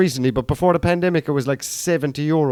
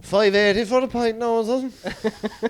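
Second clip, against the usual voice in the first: higher put-on punter voice; mock pub grumbler; 8 semitones higher, slower than usual. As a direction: moan about pint prices as a pub regular. Mocking pub goer.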